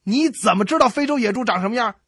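furious biology teacher shouting at the class. Retold or imitated. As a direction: bellow at the students from the podium. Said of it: "大声喊道".

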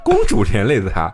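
amused disbelief through a laugh; real laughing aside. Real, not acted. Laughing at the story's logic.

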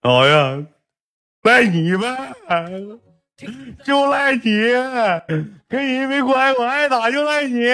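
put-on high child voice blaming and sulking; whiny kid. Grandson whining at grandpa that it's his fault, high voice. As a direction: whine it like a spoiled grandson.

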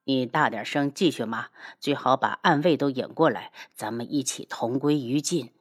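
low, controlled threat; low-pitched menacing sarcasm; cold sneer. Acted. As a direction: dare them on with icy calm. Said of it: "叶修冷笑".

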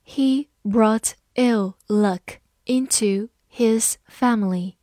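slow, breathy careful reading. Shown as a demonstration. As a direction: read it slowly for learners. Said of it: "好，慢慢来读。".

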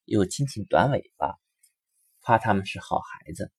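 ordinary delivery, nothing marked

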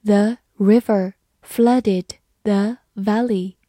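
slow, word-by-word model reading. Shown as a demonstration. Teacher demonstrates the example sentence for students, slow reading. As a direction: pause after each word so learners can follow. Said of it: "慢慢来读".

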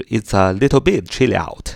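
"It's a little bit chilly out" run together as one phrase; linked speech. Shown as a demonstration. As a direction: link "It's a" and "chilly out" without gaps. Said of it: "要记着连读啊". (把"It's a little bit chilly out"连读)

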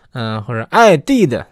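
ordinary delivery

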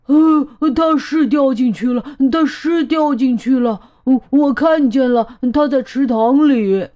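flustered alarm. Acted. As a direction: blurt it out, repeating in a fluster. Flustered elephant reports a fall.

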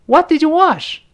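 first dialogue speaker. Acted. First person asks what was washed, high-pitched questioner. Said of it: "两个人对话开始".

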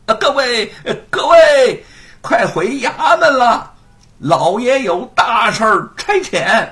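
slow, high, loud call from afar. A narrator's reading. Clerk calling the constables back: loud call. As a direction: call out to them from behind. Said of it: "传来了喊声".

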